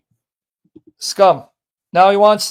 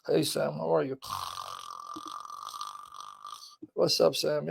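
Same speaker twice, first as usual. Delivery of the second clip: lightly imitating a sleepy caller, much softer; a hint of sleepy mockery, softer; mock drowsy greeting; 12 semitones lower, 15 dB quieter than usual. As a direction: mumble the hello as if barely awake. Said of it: "But you gotta be up, dude, not".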